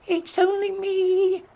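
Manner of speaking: very high, drawn-out squeak; tiny goat piping up in a squeal; squeaky little goat. A narrator's reading. Youngest goat answers the troll, squeaking. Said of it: "squeaked the youngest billy goat Gruff".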